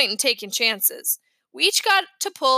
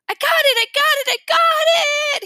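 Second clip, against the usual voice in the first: high, loud squeal of joy; excited shriek; 6 dB louder than usual. A narrator's reading. Little brother on Christmas morning, shrieking with excitement. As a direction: shriek it like an overjoyed little kid. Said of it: "he shrieked".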